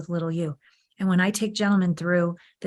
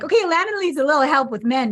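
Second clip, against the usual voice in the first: a lightly voiced higher cosmic voice; the universe speaking; 7 semitones higher, 3 dB louder than usual. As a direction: play the universe, a little amused and higher. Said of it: "the universe was like".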